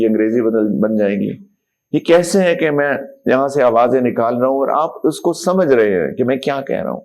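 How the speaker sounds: ordinary delivery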